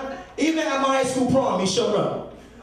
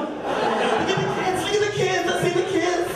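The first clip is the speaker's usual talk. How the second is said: excited crowd hype; 9 semitones higher than usual. Acted. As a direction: pump up the crowd, pitched high. Hyping crowd, pointing at kids.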